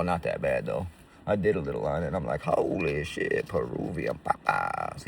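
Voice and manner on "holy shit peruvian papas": awed drawl. Retold or imitated. Voiced self tasting coke.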